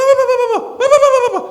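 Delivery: high, loud slurred yelling of a stroke patient; teriak-teriak. Retold or imitated. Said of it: "ada suara teriak-teriak". (teriakan keras bernada tinggi)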